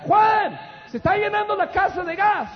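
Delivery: high, loud cry of alarm; alarmed shout. Retold or imitated. Wife yelling to husband that the house is filling with gas, alarmed shout. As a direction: yell his name in panic as the gas fills the house. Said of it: "Carla grita".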